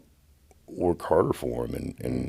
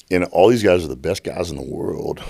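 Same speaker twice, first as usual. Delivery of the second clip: ordinary talk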